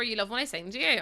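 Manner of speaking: usual speaking voice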